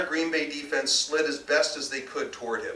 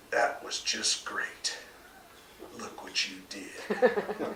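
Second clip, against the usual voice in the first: slow, soft, breathy scorn; contempt; 6 dB quieter, much slower than usual. A narrator's reading. Husband blaming his wife with slow, quiet contempt. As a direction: sneer it with contempt. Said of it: "with contempt and announced loudly".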